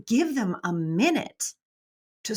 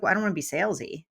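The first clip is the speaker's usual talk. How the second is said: mock excuse, rushed; 3 semitones lower, 3 dB quieter, much faster than usual. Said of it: "you're like".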